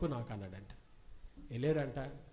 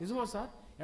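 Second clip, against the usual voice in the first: higher, more pleading echo; hopeful repeated plea; eager question repeated; 7 semitones higher than usual. Acted. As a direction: press him again, hopeful. Family eager for a cure.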